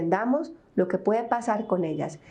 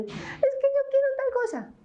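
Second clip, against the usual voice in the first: put-on very high child's whining singsong; whiny singsong. Mother imitating Valentina's whiny singsong voice. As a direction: put on the nagging little-girl whine. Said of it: "con ese sonsonete".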